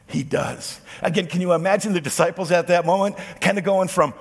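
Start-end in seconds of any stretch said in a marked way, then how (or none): none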